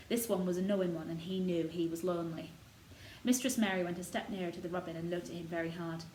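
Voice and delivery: ordinary delivery, nothing marked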